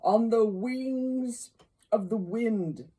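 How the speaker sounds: loud, high, long-held ghost voice; ghostly declamation. Acted. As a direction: stretch it out like a wailing spirit. Ghost answers Scrooge.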